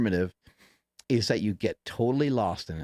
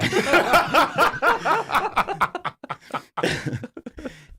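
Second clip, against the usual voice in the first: 1 loud high laughter; 6 dB louder than usual. Real, not acted. Laughs hard at punchline.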